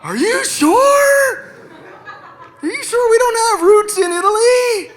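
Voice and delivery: very high, stretched-out pleading question; disbelieving kid. Acted. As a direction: squeal it like a child clinging to hope. Child disbelieves mother's revelation.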